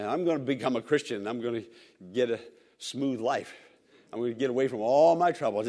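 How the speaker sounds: quick, low, lightly voiced naive believer; mock naive believer.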